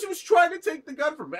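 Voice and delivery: high, loud, fast excuse; mock indignant protest. Retelling a fighter defending himself over the gun, indignant. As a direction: blurt it out in a high-pitched protest.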